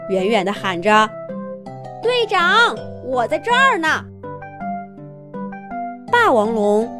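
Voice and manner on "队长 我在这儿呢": high call to someone far off; calling from afar. Acted. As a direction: call out across the yard. (远远地喊)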